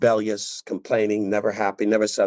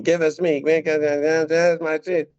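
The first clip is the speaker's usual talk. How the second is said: whiny crowd; 6 semitones higher than usual. Retold or imitated. People mocked crying for meat.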